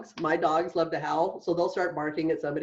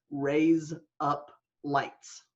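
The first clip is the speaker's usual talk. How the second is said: slow, evenly separated words; deliberate word-by-word demonstration; separate words; 2 semitones lower, much slower than usual. Shown as a demonstration. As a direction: say each word slowly and distinctly. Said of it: "is you say the words".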